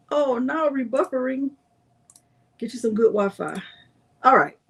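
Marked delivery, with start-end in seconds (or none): none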